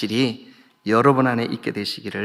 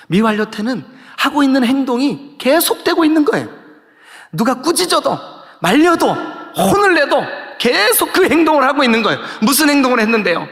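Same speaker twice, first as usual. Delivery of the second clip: high, insistent sermon delivery; fervent explaining from the pulpit; animated preaching; 11 semitones higher, 5 dB louder than usual. Real, not acted. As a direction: hammer the point with mounting urgency. Preaching at full force.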